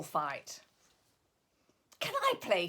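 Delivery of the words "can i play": high, eager little voice; hopeful asking. Acted. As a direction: ask hopefully. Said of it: "Figgy Two Socks asked".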